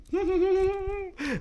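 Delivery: soft, very high titter; high-pitched quiet giggle. Acted. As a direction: titter in falsetto. Marvels that they move.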